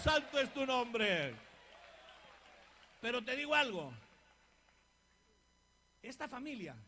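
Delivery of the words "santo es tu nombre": reverent praise. Real, not acted. Worship after the shout.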